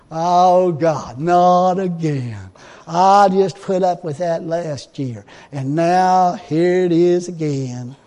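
whiner. Retold or imitated. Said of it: "I'm a whiner".

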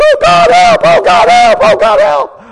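hammed-up panic, crying out to be rescued; mock panicked cry. Preacher mocking people crying to God when going under, hammed-up panic. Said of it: "when they're about to go under".

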